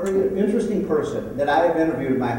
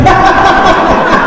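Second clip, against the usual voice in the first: high, loud burst of laughter; can't hold it in; 13 dB louder than usual. Real, not acted. Speaker cracks up at Art's joke.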